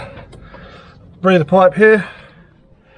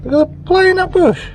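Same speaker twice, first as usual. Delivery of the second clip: surprised. Real, not acted. Surprised at loose bushing.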